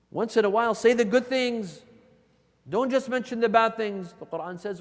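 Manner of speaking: loud, high exhortation; impassioned, insistent sermon; forceful preaching. Acted. Exhorts the congregation at full force.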